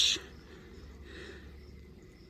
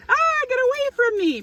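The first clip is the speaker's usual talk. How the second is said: high, frightened cry of get away; a puppet flinching from bees, voice jumping high; panicked shriek; 14 semitones higher than usual. Acted. Puppet Edgar shrieking at the bees, panicked and high.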